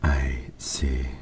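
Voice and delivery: a hushed, thoughtful whisper. Acted. King reacts to odd name.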